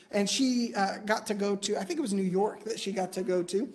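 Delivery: usual speaking voice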